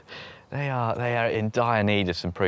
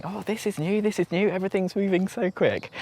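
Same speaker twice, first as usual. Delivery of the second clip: high, breathless mock excitement; a touch of hammed-up frenzy; mock frantic; 9 semitones higher than usual. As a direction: send up your own frantic tour voice. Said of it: "a bit frantically going".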